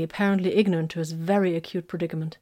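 ordinary delivery, nothing marked

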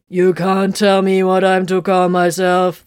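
loud, slow, indignant protest. Acted. Machine spirit protests to Tiberius over its name, indignant. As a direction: push back, offended. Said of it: "the voice protested".

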